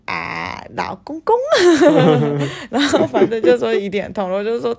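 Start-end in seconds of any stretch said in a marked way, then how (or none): 1.4-2.6 loud rising giggle, higher; real giggle breaking out; rising laugh. Real, not acted. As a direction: burst into a loud climbing laugh. Laughs at own story.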